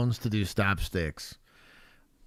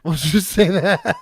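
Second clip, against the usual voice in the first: laughing, a bit louder; 8 semitones higher, 10 dB louder, slower than usual. Real, not acted. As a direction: say it through a laugh. Laughing euphemism.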